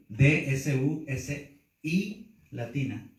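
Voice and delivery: letter by letter "D S U S I", slowly; slow spelling out. Shown as a demonstration. Teacher dictates a Greek word to note-takers, slow spelling. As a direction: spell out "D S U S I" with space between letters. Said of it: "pero lo repito".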